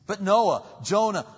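loud, high objection; protesting. Acted. Acts believer protesting with examples.

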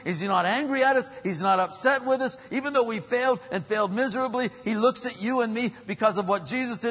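high, loud, urgent pulpit delivery; full-force preaching. Real, not acted.

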